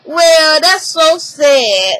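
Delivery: loud, drawn-out sarcastic pity; sarcastic oh-so-sad; mock sympathy. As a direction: fake sorrow, stretched out slow. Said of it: "And she's like".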